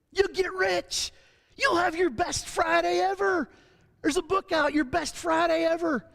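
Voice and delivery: lightly hammed-up television preacher promise; TV preacher parody. Preacher mimicking false gospel on television screens, mock salesman pitch.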